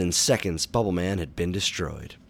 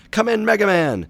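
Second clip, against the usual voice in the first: high, loud frantic yell; urgent, panicked call for a response; 8 dB louder than usual. A narrator's reading. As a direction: yell desperately into the radio. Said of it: "Doctor Light sounded frantic".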